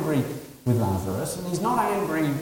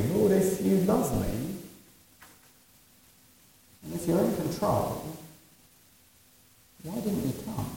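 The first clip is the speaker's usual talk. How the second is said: quiet plea; 4 semitones higher, 7 dB quieter than usual. Retold or imitated. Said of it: "we can ask similar questions".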